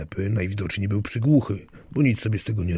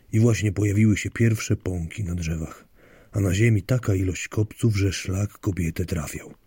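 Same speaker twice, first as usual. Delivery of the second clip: ordinary delivery, nothing marked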